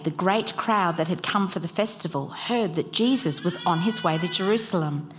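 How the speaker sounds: usual speaking voice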